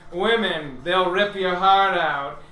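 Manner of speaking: drawn-out sardonic quip; mock wry warning. GM voicing Puyo Puyo cracking a joke about women. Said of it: "You hear Puyo Puyo say".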